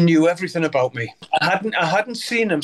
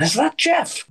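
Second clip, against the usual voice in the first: imagined surprised schoolmate; 8 semitones higher than usual. Retold or imitated.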